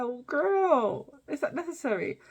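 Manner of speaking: high-pitched judging reaction; mock disbelief. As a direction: act out a scandalised "oh, girl". Acting out her own scandalised reaction.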